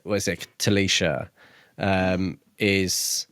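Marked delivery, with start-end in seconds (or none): none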